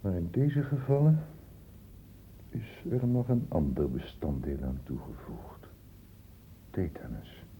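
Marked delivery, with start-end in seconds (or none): 2.5-7.4 hushed, slow, low whisper; ominous whisper. Acted. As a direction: drop to a secret whisper for the reveal. Dramatic reveal of hidden ingredient. (onheilspellend gefluister)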